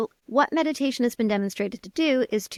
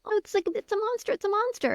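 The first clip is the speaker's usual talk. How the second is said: put-on high, quick panicked kid; much higher, rushed alarm; child freaking out; 11 semitones higher, faster than usual. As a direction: voice a child panicking about a monster. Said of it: "the child freaking out".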